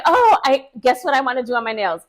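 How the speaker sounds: loud, high, rushed announcement; hammed-up gushing client; mock-excited client. Client announcing her nail choice to the technician, mock excitement.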